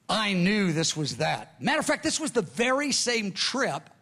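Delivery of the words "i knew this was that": usual speaking voice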